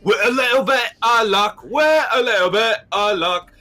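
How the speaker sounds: high, loud music-hall Cockney; loud Cockney imitation, high and broad; My Fair Lady Cockney. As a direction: belt it like a flower girl. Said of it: "like, My Fair Lady".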